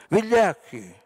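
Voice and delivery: high, loud cry of cowards; shouted insult. A narrator's reading. A man in the crowd shouts at the bombers. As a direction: hurl the word at them. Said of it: "urlò qualcun altro".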